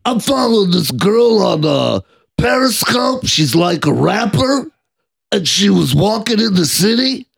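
put-on high whiny drunk voice; Jeff the Drunk impression. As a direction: ramble it high and slurred. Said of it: "same with Jeff the Drunk".

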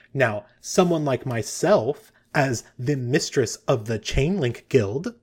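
slow, self-important announcement; pausing for effect. Acted. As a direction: draw it out to impress the room. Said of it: "she sort of pauses for effect".

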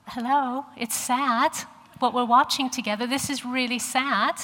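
retold tearful protest. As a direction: protest as if it were obvious. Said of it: "And I'm like".

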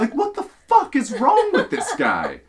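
high, loud incredulous outburst; real baffled exasperation; exasperated disbelief. Real, not acted. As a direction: demand an answer, voice jumping up. Exasperated rant about the trainee.

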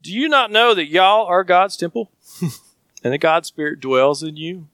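scripture read with a playful Southern y'all; verse read in a Southern voice with a laugh. Shown as a demonstration. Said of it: "with a good Southern bravado".

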